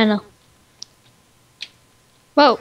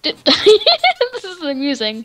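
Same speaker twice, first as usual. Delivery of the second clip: laughing; 4 semitones higher, 3 dB louder, much slower than usual. Real, not acted. Laughing at friend.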